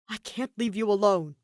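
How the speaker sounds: stubborn insistence. Acted. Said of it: "stubbornly insisted".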